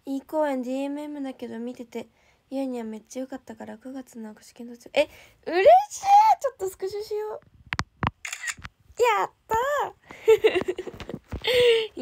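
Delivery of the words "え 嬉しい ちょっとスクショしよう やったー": high, loud delighted squeal stretched out long; thrilled squeal. Real, not acted. Idol squealing at a fan's good news, thrilled. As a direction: burst out with real delight.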